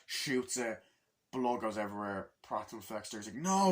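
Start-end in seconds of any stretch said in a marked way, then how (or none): 3.4-3.7 high, loud cry of no; shouting no; anguished scream. Retold or imitated. Character screaming as shot.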